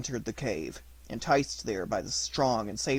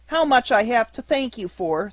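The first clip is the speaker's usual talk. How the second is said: high puppet voice; 9 semitones higher, 6 dB louder than usual. Acted. Puppet thanks dog.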